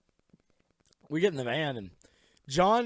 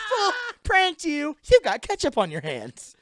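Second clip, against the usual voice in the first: prank toy voice; 13 semitones higher, 7 dB louder than usual. Retold or imitated. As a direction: taunt like a talking prank doll. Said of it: "that voice said everything".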